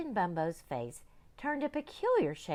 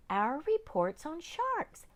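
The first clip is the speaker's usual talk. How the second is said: put-on high, slow little girl; high, slow girl's voice; young girl; 11 semitones higher, slower than usual. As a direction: explain it like a patient girl.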